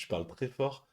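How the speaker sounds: ordinary talk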